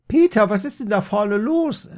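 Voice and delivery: high, loud call across the house; mother calling out. A narrator's reading. Mother yells to son at the front, high loud call. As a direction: holler like a nosy mother. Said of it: "rief die Mutter".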